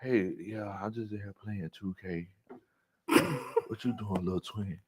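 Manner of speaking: soft, low, laid-back murmur; smooth sweet-talker. Retold or imitated. Imitating him chatting from the garage, soft and low. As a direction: keep it hushed and casual, chatting up a sweetheart. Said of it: "You be in that cake and".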